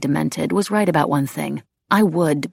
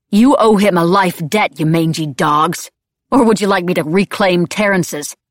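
furious rising voice; 2 semitones higher, 7 dB louder than usual. A narrator's reading. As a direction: hurl it as a threat. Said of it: "My voice rose, grew into something".

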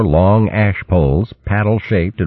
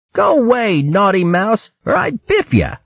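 much higher, tiny scolding threat; baby puffing up to sound tough; stern baby squirrel. A narrator's reading. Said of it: "Chugger looked stern".

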